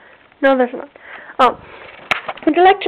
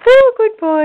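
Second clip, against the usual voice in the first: high, loud pet-praise voice; praising the dog; 8 semitones higher, 5 dB louder than usual. Real, not acted. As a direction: gush over the dog like talking to a pet.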